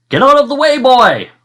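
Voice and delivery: high, loud command; ordering shout. Acted. The king shouts an order at Boy in the battle. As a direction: bark the order at him. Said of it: "ordered the king".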